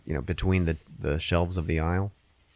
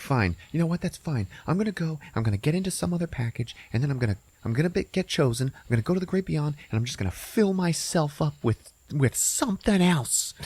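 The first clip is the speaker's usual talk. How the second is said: huffy breakup rant; 8 semitones higher than usual. Retold or imitated. Brenda in breakup fight.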